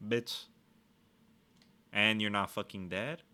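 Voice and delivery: ordinary talk, nothing marked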